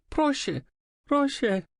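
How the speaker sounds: pleading Proszę, high and fading. A narrator's reading. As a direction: whimper the word. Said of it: "over and over".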